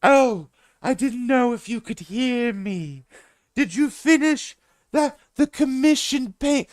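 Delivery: high, slow, drawn-out put-on greeting; odd stranger voice. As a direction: stretch every word, high and strange. Stranger asking about painting.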